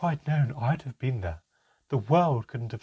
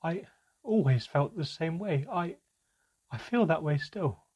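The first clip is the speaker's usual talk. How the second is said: higher, halting, tearful apology; sobbing; 7 semitones higher, 3 dB louder than usual. Acted. Mother sobbing out her apology to Manka, tearful. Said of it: "My mother was sobbing now".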